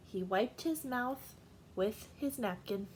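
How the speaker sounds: usual speaking voice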